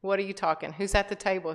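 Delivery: normal talking voice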